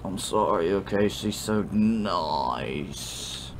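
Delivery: put-on slurred, rambling drunk; sloppy drunk apology. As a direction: stumble tipsily and drag out the last words. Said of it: "what it sounds like when you're drunk".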